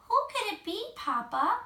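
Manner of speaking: high little cub voice, puzzled; a small bear asking its father; wondering Baby Bear. A narrator's reading. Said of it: "wondered Baby Bear".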